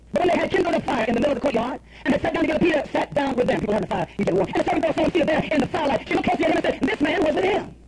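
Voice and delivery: high, emphatic Bible reading; dramatic scripture reading. Acted. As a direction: read the verse out like a preacher. Reads scripture aloud dramatically.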